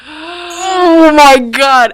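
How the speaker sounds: long drawn-out, high, loud gasp of shock; hammed-up amazed outburst; retold astonishment. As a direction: stretch it out in stunned realization. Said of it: "and I was like".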